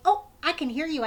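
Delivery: plain reading voice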